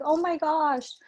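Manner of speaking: high startled oh my gosh; shocked gasp. Retold or imitated. Retelling the movie's twist to a friend, high startled exclaim. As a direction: jump up in pitch in disbelief. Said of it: "So I'm like".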